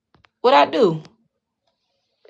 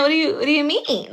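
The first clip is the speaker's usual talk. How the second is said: usual speaking voice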